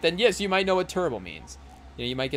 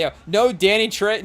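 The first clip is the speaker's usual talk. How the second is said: ordinary delivery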